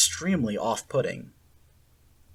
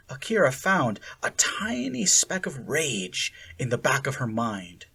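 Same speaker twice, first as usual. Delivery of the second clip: ordinary delivery, nothing marked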